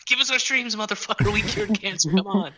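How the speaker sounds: high, whiny demanding gamer; mock-plead like an entitled gamer.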